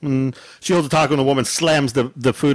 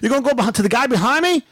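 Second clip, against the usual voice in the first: high, incredulous complaint; retold outrage; 9 semitones higher, faster than usual. Customer skipped for the guy behind, outraged. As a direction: pitch it up in disbelief. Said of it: "I was pissed off".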